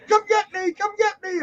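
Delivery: squeaky-high imitation of a falling friend; high mock plea. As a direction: call out high, as if dropping away. Voices falling friend calling out.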